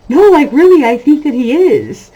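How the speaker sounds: insistent. Retold or imitated. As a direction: push back hard, half laughing. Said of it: "And I'm like".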